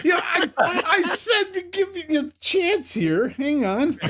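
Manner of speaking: words squeezed out through high laughter; can barely talk for laughing. Real, not acted. Host cracking up before video.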